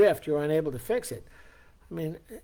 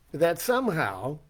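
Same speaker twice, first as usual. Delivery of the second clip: ordinary talk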